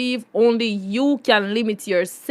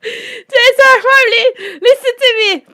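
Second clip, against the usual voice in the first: very high, squealing through laughter; laughing hard; 12 semitones higher, 5 dB louder than usual. Real, not acted. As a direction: barely get it out for laughing. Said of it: "I was laughing so hard".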